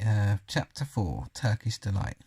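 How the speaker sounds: ordinary delivery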